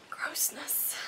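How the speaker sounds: breathy whispered disgust. Real, not acted. Recalling the book's withdrawal scenes, whispering in disgust. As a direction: drop to a whisper on the word, grossed out. Disgusted word at end of list.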